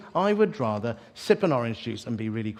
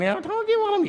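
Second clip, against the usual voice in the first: high-pitched little nagging voice; little inner voice; 3 dB louder than usual. Retold or imitated. The Lord reminding the preacher, in a high little voice. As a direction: pipe up high, imitating a small insistent voice. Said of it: "you hear that little voice again".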